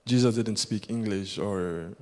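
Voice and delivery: ordinary delivery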